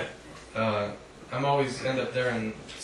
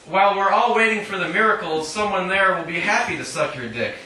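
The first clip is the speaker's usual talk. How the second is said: measured, deliberate reading voice; poetry reading; 7 semitones higher, 7 dB louder than usual. Acted. Poet reading her own poem to an audience, measured reading voice. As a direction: read each line out to the room.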